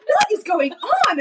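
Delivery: very high, drawn-out, laughing disbelief; squealed, stretched-out incredulity; hysterical bewilderment. Retold or imitated. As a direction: wail it in amused astonishment. Acted bewilderment at own urges.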